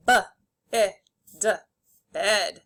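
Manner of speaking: "b, i, d" as isolated sounds, then "bid" whole; sounded out, blended. Shown as a demonstration. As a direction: segment each sound of "bid", pause between, then blend. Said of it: "say the sounds and then blend".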